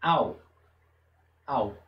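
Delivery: "Au" with the A strong and a faint O; Hebrew kamatz sound. Shown as a demonstration. Teacher models the kamatz vowel, faint O. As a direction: say "Au", the O barely heard. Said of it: "A pronúncia que chega mais perto".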